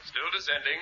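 soft, distant radio voice; quiet report from far below; weak radio signal; faint over radio. Acted. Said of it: "You're not very loud".

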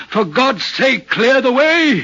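high, loud cry of warning; urgent warning cry. Acted. Signalman acting out the figure's signal, urgent warning cry. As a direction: cry out to clear the track, building. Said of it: "as though he were trying to signal me".

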